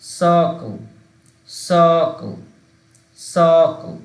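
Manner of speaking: circle said loud with a long আ; model word. Shown as a demonstration. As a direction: project circle for the class to copy. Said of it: "শেষেরটা হচ্ছে".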